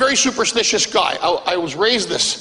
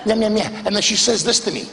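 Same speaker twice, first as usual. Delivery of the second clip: normal talking voice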